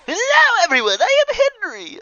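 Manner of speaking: very high, loud cheery announcement; loud overjoyed self-introduction; booming greeting. Acted. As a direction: shout it to the whole room. Character bursts into diner greeting.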